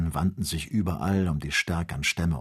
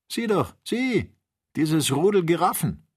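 higher, eager look-there call; excited pointing; 10 semitones higher, 4 dB louder than usual. A narrator's reading. Hunter showing the others a herd of giraffes, eager call.

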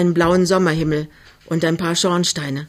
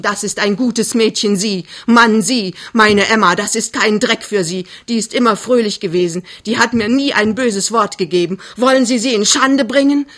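higher, louder, mounting indignation; furious tirade; 4 semitones higher, 5 dB louder than usual. Acted. Mother defends her girl to suitor, furious. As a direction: defend your daughter, building to a shouted accusation.

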